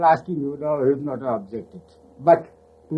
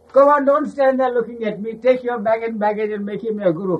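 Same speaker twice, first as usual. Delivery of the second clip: mock scolding; 8 semitones higher than usual. Baba scolding disciples, raised teasing command. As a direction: drive them off with teasing scorn.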